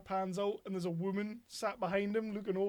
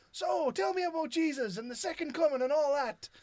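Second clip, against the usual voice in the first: mock keen host; 9 semitones higher, 8 dB louder than usual. Host quizzing the preachers.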